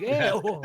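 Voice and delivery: high loud disgusted squeal. Retold or imitated. Friend squeals ew describing a brutal film. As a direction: shriek it out in disgust. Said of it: "It's like".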